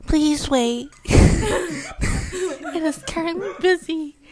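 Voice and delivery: mock recorded phone voice, flat and formal, broken by laughs; lightly voiced phone robot through laughter; automated hold message. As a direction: play the hold recording while cracking up.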